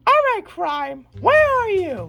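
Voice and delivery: high, heroic call-out; superhero sister. Acted. Sister in superhero outfit calls out to crime, high heroic voice. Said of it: "and then she goes".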